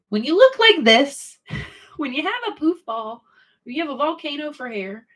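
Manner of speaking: playful high-pitched mock voice; goofy high voice; silly high voice. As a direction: squeak it out, amused at herself. Silly high voice about her look.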